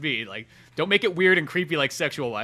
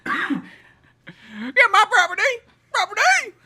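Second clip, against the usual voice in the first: hillbilly monster; 12 semitones higher, 5 dB louder than usual. Retold or imitated. Voicing Fuzzy Lumpkins.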